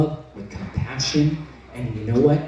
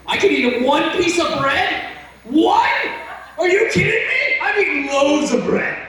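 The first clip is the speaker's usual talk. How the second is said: high laughing disbelief; can't believe it, laugh it out; incredulous; 12 semitones higher than usual. Retold or imitated. Amazed one slice fills him, high laughing disbelief.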